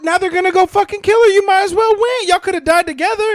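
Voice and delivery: loud exasperated. Real, not acted. Exasperated rant about the ending.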